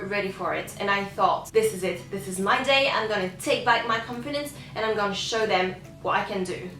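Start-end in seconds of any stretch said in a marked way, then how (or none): none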